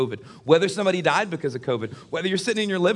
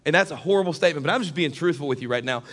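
ordinary talk, nothing marked

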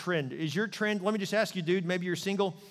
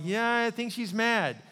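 slow, higher knowing aside; knowing husband; 7 semitones higher, slower than usual. Retold or imitated. Husband admits to himself his wife is angry, knowing. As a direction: realize the obvious, drawn out.

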